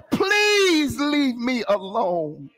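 high, drawn-out mock wail on PLEASE; crying out in plea. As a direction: stretch PLEASE into a slow, pleading cry. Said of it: "What he was crying out".

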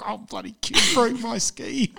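laughing through a mock grumble; mock moping. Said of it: "You can imagine me moping around".

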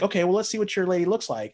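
high, quick eager demand; eager curiosity. Retold or imitated. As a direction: push excitedly to see the photo. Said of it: "we're like".

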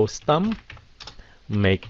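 stomach. stretched very slowly, apart from ache; very slow split word. Shown as a demonstration. Teacher slowing down stomach for viewers. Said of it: "làm như vậy đó".

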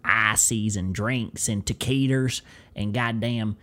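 slow, drawn-out Southern storytelling; Southern accent. Pretend. Host listing gas station snacks, slow Southern accent. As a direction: stretch the vowels like a country storyteller. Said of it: "start leaning into the Southern accent".

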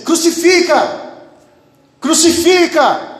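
high, drawn-out mob cry; imitated crowd shout. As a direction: shout it like the crowd demanding a death. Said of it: "começam a gritar assim".

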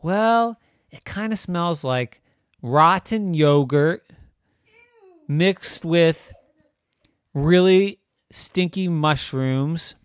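drawn-out loud, savoring each stink; slow relish of gross details; slow gross-out build. Acted. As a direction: linger on every disgusting item.

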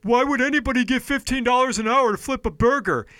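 hammed-up scoffing skeptic; mocking a critic. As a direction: sneer it like a clueless know-it-all. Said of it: "the people that are like".

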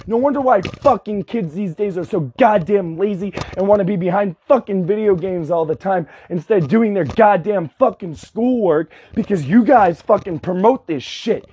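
ordinary delivery

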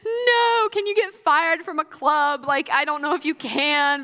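high, teary, panicky; a sobbing teen begging not to be fired; upset teen crying to her mom; crying protest. Retold or imitated. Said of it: "And I was like".

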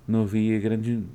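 ordinary delivery

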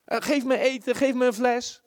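an eighteen-year-old still demanding, mock whining; whiny baby. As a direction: whine like a grown baby. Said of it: "je kind met achttien".

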